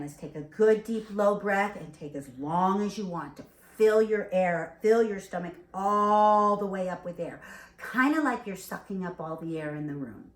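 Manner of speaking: normal talking voice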